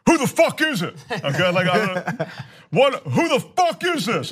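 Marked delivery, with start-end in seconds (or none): 0.1-1.0 high-pitched, combative challenge; aggressive yell. Acted. Host yelling at a late-night knock, aggressive. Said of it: "like, really aggressive". 2.7-4.3 high, forceful demand; aggressive yell. Acted. Acting out yelling at the doorbell ringer, aggressive. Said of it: "just like that, like, really aggressive".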